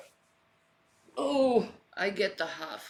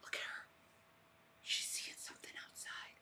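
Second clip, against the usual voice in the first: hushed, unvoiced and quick; whispering; 19 dB quieter, faster than usual. Real, not acted. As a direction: whisper so the cat isn't disturbed. Quietly watching the cat.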